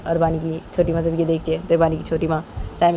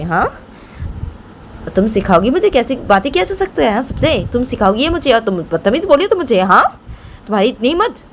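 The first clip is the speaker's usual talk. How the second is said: high, insistent accusing voice; indignant grandmother; 8 semitones higher than usual. Acted. Grandmother accuses Rahma of calling her rude, indignant. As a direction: press the accusation with rising, heated questions.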